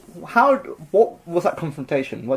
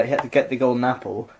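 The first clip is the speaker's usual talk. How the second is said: usual speaking voice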